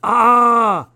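high, loud pained cry; anguished yelp. Acted. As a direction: yelp as if stung. Cry after being called immature.

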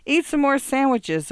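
high, loud party shriek. Acted. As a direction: shriek it over the noise. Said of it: "a woman shrieked".

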